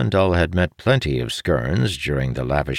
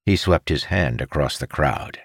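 plain reading voice

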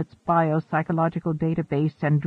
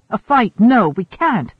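higher, louder protest; urgent cry; 5 semitones higher, 6 dB louder than usual. A narrator's reading. Woman holding back an attacker, urgent cry. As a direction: cry out to stop them. Resisting, calling for help in scuffle.

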